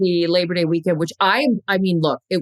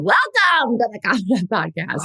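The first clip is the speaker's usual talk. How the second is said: big, put-on podcast intro voice; hammed-up host greeting; exaggerated host welcome. Shown as a demonstration. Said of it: "that's better than".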